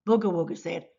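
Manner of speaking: ordinary delivery, nothing marked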